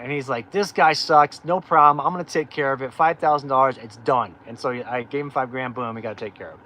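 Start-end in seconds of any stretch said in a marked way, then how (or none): 0.5-4.3 loud, brash, swaggering promise; confident, brassy, a bit louder; cocky lawyer. Retold or imitated. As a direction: play the tough little lawyer with attitude. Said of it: "He had a major attitude".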